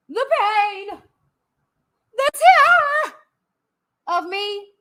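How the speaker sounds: high and loud, hamming up terror; theatrical mock-terror on one word; mock dramatic terror. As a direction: play up the word like a horror trailer.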